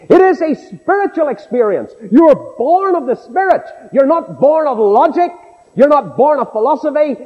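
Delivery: high, ringing pulpit declaration; impassioned preaching. Acted. Preacher explains new birth to his congregation, impassioned preaching. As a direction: drive each statement home at a high pitch.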